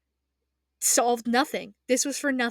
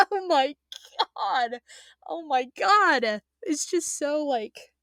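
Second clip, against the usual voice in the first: passionate, overwhelmed; 4 semitones higher, slower than usual. Real, not acted. Fan reliving Zeke's last words, passionately overwhelmed. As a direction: cry it out, overcome by how moving it is. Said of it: "I'm really passionate about this".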